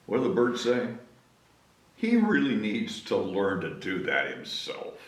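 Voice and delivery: usual speaking voice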